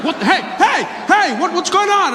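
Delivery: high-pitched, startled cop; freaked out. Acted. Cop startled by a driver playing dead, freaked out. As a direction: yelp it high, tripping over the start. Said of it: "which freaked him out".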